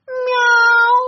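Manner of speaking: high, loud cat meow. A narrator's reading. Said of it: "кто-то громко сказал".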